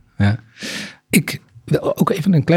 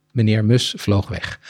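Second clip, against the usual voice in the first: ordinary talk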